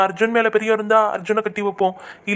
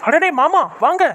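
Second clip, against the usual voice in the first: glad surprise; 6 semitones higher, 3 dB louder than usual. Acted. Welcoming father-in-law home.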